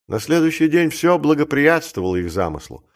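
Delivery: ordinary delivery, nothing marked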